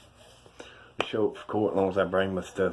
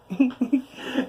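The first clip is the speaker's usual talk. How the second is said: short high scoffing laugh; a real, brief high laugh of disbelief; 13 semitones higher than usual. Real, not acted. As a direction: laugh off the cowards riding by. Scoffing at drive-by churchgoers.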